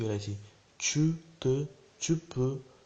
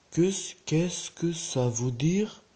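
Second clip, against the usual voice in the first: very slow, higher, rising question; slow model pronunciation; 5 semitones higher, 4 dB louder, much slower than usual. Shown as a demonstration. Teacher drilling learners once more, very slowly. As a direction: stretch each word, lifting the end.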